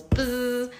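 drawn-out buzzing noise; imitated buzzer. Agent presses the buzzing button, imitated long buzz. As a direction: stretch it out like a door buzzer. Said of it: "he presses the buzzing button".

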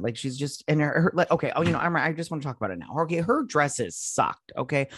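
ordinary talk

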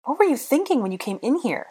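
high-pitched demanding question; demanded. A narrator's reading. Hermione to Draco in the dark, demanded. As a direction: interrogate him indignantly. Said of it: "she demanded".

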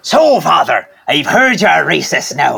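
Irish sitcom priest voice; Father Ted quote. Retold or imitated. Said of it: "my favourite joke of that whole show".

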